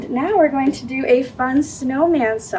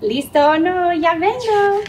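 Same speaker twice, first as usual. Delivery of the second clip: higher, louder playful call; 6 semitones higher, 5 dB louder than usual. Acted. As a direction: call out to the hiding sister. Hide and seek, calling out.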